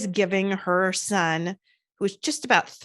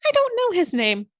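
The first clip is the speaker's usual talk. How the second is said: put-on innocent child; 10 semitones higher than usual. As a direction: tell it brightly like a three-year-old. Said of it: "He said".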